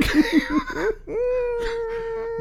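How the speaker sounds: high wheezing laughter fit; shrieking laugh. Real, not acted. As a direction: laugh till it squeaks.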